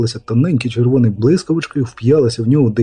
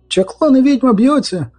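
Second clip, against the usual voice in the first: high-pitched alarmed announcement; breaking news to the street; excited cry; 12 semitones higher than usual. Acted.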